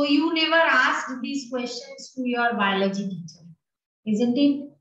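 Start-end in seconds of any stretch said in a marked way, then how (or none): none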